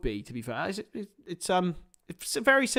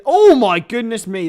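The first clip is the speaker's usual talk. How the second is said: excited; 5 semitones higher, 8 dB louder than usual. Real, not acted. Near miss.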